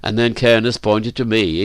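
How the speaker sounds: ordinary delivery, nothing marked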